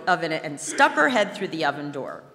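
plain reading voice

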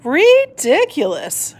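slow, drawn-out scoff; scoffing cry. A narrator's reading. Father tortoise dismisses Shelley, slow scoffing cry. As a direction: stretch the word in disbelief. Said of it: "cried Mister and Missus Tortoise together".

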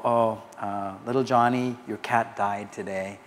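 low, soft sorrowful news-breaking; sad mom. Retold or imitated. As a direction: gently imitate a sad mother. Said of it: "Mom looks very sad".

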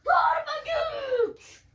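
stretched, high straining cry; slow power-up yell. Pretend. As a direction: drag it out like a hero powering up. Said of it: "turbo chant, slow shouted take".

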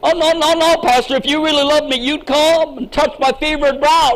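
mock-needy plea to be touched; whiny sick congregant. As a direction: wheedle the pastor into coming over. Sick congregant demanding a visit.

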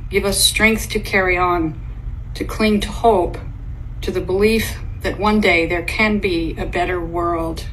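ordinary talk, nothing marked

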